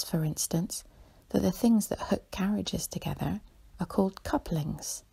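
ordinary delivery

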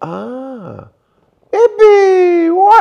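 very high, loud whoops of joy; dad cheering every bump of the plane; dad whooping. Retold or imitated. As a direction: yell it like a kid on a roller coaster. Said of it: "Entonces yo hago".

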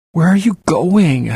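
high, loud, slow anxious plea; child afraid of abandonment; frightened cry. A narrator's reading. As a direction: call out in fear of being left. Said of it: "I asked from my ball on the floor".

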